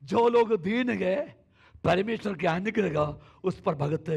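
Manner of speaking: high, loud, slow preaching; full-force preaching. Real, not acted. Pastor preaching healing to the congregation, loud and high. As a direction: proclaim it to the whole church.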